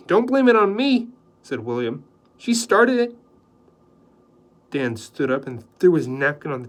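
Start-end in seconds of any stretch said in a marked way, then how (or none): 0.1-3.2 protesting. Acted. Houseboy protests loudly.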